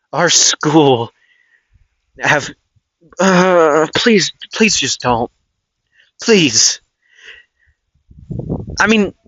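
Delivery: acted pleading. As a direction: plead as the lunch lady, please after please. Lunch lady begs them not to fight.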